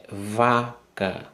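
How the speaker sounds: VACA drawn out slowly as "va... ca"; syllable by syllable. Shown as a demonstration. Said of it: "A primeira sílaba".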